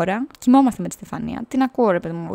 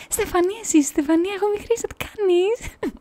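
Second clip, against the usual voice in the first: much higher, sugary put-on voice, slowing at the end; imitating an overly sweet introduction; mock polite greeting; 11 semitones higher than usual. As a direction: ham up the friend being overly nice to a new person. Friend politely greets a stranger.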